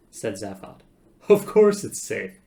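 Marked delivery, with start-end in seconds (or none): 1.3-2.4 loud, high, drawn-out insistence; scornful reassurance. Acted. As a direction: wave the fear away loudly. Zaphod dismisses the worry.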